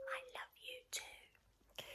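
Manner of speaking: voiceless whisper, high; the boy mouthing to his mother; mouthed back. Acted. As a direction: breathe it back silently. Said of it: "the boy mouthed back".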